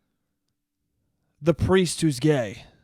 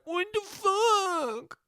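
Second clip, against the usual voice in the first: much higher, slow, whiny wounded squeak; mocking dad stretching out his hurt disbelief; wounded softy dad; 5 dB quieter, much slower than usual. Son mocking his sensitive dad when insulted, whiny squeak. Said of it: "he's like".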